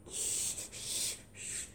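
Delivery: repeated soft shh; quiet, drawn-out shushes; urgent shushing. Acted. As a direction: hush everyone at once. Lucia shushes the others.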